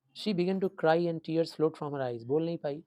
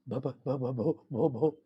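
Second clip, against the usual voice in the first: stuck on the first sound; hint of a child's stammer; much slower than usual. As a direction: stutter nervously. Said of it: "ऐसे बोल रही है".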